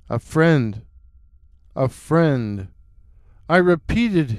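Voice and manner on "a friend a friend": low, hollow and distant-sounding; deep hollow voice. Acted. Stranger by the fire greets the hunter, deep hollow voice. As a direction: speak low, as if from far off in the dark. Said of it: "in a deep, hollow-sounding voice".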